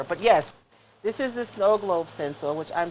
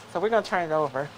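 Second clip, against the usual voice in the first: usual speaking voice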